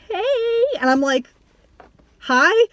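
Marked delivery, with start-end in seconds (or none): 0.1-0.6 a high, sing-song flirty greeting; flirty hey. Retold or imitated. Mocking a guy hitting on her at an event, high flirty hey. 2.2-2.7 a high, louder baffled hi with a rising tune; confused hi. Retold or imitated. Replying to a guy flirting with her, baffled high hi.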